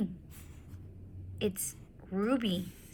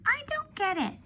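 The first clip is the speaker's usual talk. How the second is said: high, innocent little-elephant voice, puzzled; young elephant Ruby. Acted. Ruby asking Ivan about the carrots, high and puzzled. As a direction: play Ruby as a small, curious child. Ruby's voice in the read-aloud.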